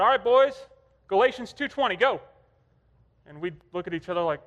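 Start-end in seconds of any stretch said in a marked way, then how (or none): 0.0-2.2 put-on loud, raised call to the boys; dad yelling out. Dad quizzing sons in the back seat, yelling out. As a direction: imitate a dad calling out a quiz. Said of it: "Dad would yell out".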